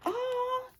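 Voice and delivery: high soft oh; customer remark. Retold or imitated. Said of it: "my customers would be like".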